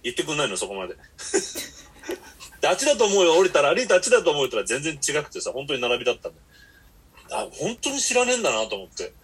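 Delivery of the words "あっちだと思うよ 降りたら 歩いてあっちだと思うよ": louder, casual driver voice tossing off a guess; imitating the driver. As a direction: brush it off like a careless cabbie. Osaka taxi driver quoted. (いい加減なタクシー運転手の口まね、大きめの声で)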